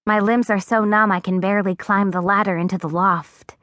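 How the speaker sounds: ordinary delivery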